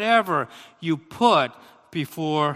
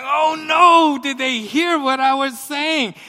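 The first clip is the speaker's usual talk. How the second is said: high, loud, alarmed re-enactment; hammed-up dismay at being overheard; mock panic; 9 semitones higher, 6 dB louder than usual. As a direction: cry out in dawning horror. Acts out panic at accidental call.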